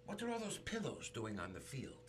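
a light hint of a German immigrant mother's accent; puzzled mama, lightly German-accented. Mother at Yankee Stadium asking about the bases, light German accent. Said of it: "the German accent".

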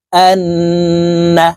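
"আন্না" with a very long hummed double n; nasal gunnah. Shown as a demonstration. Teacher showing ghunnah, "আন্না" drawn out slowly through the nose. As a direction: hold the nn of "আন্না" in the nose before the final vowel. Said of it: "নাকের বাসি থেকে তিন second পরিমাণ".

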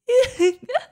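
sharp, high-pitched villain laugh; gleeful wicked laugh; high giggle. Acted. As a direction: cackle with delight at their fear.

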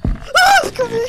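high panicked scream; being chased. Real, not acted. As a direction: shriek as it closes in.

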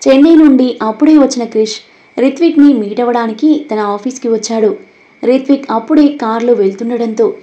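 usual speaking voice

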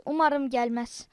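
usual speaking voice

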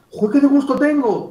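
high-pitched burst of pleasure; delighted client. Acted. Client telling the coach they feel great, delighted. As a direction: act thrilled with delight. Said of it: "Trata de acercar un poco tu emoción".

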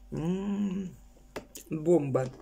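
talking while chewing food; muffled words around food; mouth full, chewing. Real, not acted. Seen 1: Bit pastry at -5; lips closed, cheeks working while speaking.

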